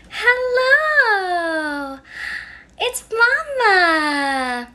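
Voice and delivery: high, slow and loud, stretched out; a mother's exaggerated hello to her infant; sing-song baby talk. Shown as a demonstration. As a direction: greet the baby like it's the best news ever. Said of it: "I can't even do it without making facial expressions".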